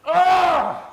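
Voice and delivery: high, loud yell of effort; loud cry. Pretend. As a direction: shout as the worm gives. Worm struggles in tug game.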